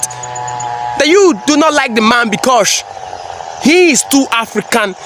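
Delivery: normal talking voice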